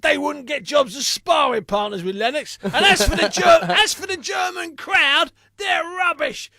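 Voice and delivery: high, loud shouted rant with a laugh breaking in; Frank Maloney's rant. Retold or imitated. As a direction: blow up on air, mocking and outraged. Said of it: "he said".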